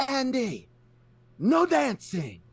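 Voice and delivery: very high, slow yelled warning from across the room; yelled from far away. Retold or imitated. Old lady at the roulette table yells at Andy across the lobby, high drawn-out shout. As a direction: shout his name then stretch out the ban. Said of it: "yells from across the way".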